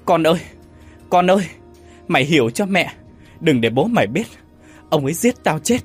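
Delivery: high, loud maternal pleading; loud panicked cry. A narrator's reading. Caught mother begging her son to keep the secret, crying out. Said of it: "vội chạy ra phòng khách la lớn".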